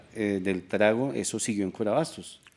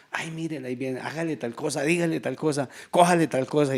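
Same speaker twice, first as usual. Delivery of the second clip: mocking catcalls; 5 semitones higher than usual. Market men jeering at passing women, mocking catcalls. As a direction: egg the buddies on, jeering.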